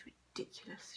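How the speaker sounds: very soft, whispered mutter. A narrator's reading. Teacher talks to herself, muttering. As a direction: say it under the breath. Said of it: "she muttered".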